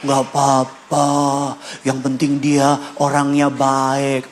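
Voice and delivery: slow, lazy mock shrug of a lax parent. Parent excusing child's partner, lazy mocking drawl. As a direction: drawl it like a parent who won't bother. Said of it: "Jangan ngomong gini".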